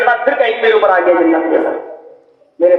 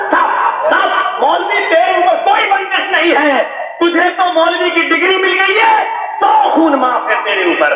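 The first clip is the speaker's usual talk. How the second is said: sarcastic taunt; 8 semitones higher than usual. Acted. Sarcastic rebuke of maulvi.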